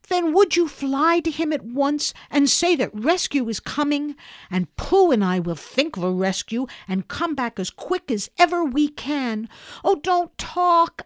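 put-on high, insistent boy's voice; urgent young rescuer. Boy sends Owl flying to Piglet, impatient and high.

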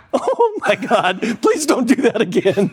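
high-pitched, appalled plea; comically horrified; mock horror. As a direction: retell it as aghast, pleading up high. Horrified reaction to self-stabbing, retold.

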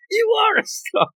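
high-pitched mock outcry; mock accusation. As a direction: accuse in a squeaky, put-on cry. Acted accusation in retold scene.

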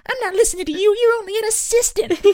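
high, loud mock self-important cop; hammed-up bossy deputy voice; pompous assistant cop. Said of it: "assistant cops".

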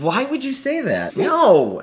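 loud outraged no; indignant. Real, not acted. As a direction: protest in mock shock. Protesting the rats joke.

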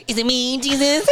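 high, sing-song mock question; mocking mimicry. Jesus mimicking Judas at supper, mocking high whine. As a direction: mimic the question back in a squeal.

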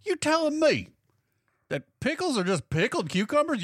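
shocked. Pretend. Clueless people learning about pickles, mock shocked and high. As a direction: gasp it out in disbelief. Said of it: "people are like".

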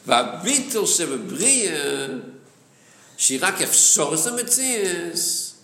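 chanted study reading, key words lifted high; singsong text reading. Acted. Teacher reading the Hebrew text to students, singsong. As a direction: read it out in a lilting learning chant.

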